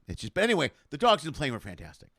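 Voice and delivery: ordinary delivery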